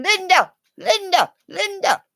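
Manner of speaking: put-on high, repeated child's call of Linda; be the little kid calling Linda. Little kid on the video calling Linda, high child voice. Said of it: "And he's going".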